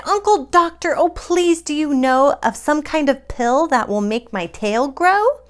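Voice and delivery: a lightly voiced pleading bird; cried out. Gertrude pleads with her uncle, mildly whiny. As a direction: beg the doctor for help. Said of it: "she cried".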